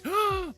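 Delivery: sharp high gasp of alarm; shocked gasp. Real, not acted. Reacting to tornado news.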